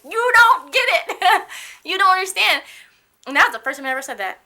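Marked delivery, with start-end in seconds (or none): 0.0-2.6 loud, high, drawn-out retold yell at the boss; P.O.'d outburst. Patient retelling how she told off her boss, angry shouting. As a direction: replay blowing up, far higher and louder than usual. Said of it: "I was P O'd, not happy".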